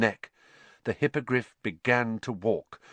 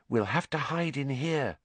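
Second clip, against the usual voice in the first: higher, hushed and anxious; shaken; 4 semitones higher, 5 dB quieter than usual. Acted. Hermione hiding in the forest, shaken hush. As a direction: say it softly, rattled. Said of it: "who looked very shaken".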